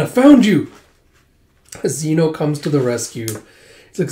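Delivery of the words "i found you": high, loud triumphant call; excited rescuer. Acted. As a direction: burst in to the rescue. Zeno bursts in to rescue.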